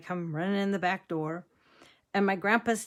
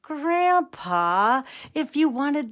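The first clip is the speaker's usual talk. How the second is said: high, drawn-out exasperated retort; mock exasperation; 6 semitones higher, 3 dB louder, slower than usual. Granddaughter finally understands grandpa, mock exasperation. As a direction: stretch the name, then scold in disbelief.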